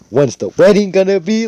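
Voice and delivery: high, loud gushing question; hammed-up excitement; overexcited mom. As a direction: squeal it like an eager mother. Excited mom jumps to wedding.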